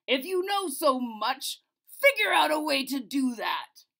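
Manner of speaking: loud royal shout; the king shouts at the mathematician. A narrator's reading.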